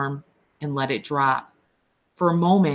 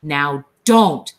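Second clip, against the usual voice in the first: angry; 7 dB louder than usual. A narrator's reading. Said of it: "Then Lenny grew angry".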